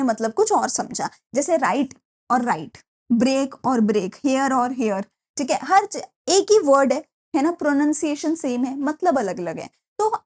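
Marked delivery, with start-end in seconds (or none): none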